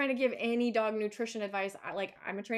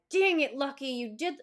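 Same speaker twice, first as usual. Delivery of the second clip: mild mock scolding; 4 semitones higher, 6 dB louder than usual. Shown as a demonstration. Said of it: "switch and then be like".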